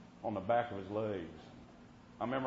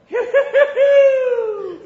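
very high, loud imitated whistle calls; whistle holler; 18 dB louder, much slower than usual. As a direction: holler the boy's phew phew like he did. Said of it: "he'd just holler".

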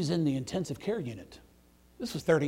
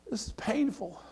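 a higher, whining mock of the thief; 11 semitones higher, 3 dB louder than usual. Thief on the cross mocking Jesus, higher whine.

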